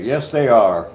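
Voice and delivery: ordinary talk, nothing marked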